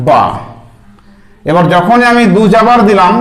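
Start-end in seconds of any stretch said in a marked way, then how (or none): 0.1-0.2 bare syllable BA, held alone; teacher sounding a letter; sounded out. Shown as a demonstration. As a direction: say বা as a clean single syllable. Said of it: "বা জাবার কি হবে?".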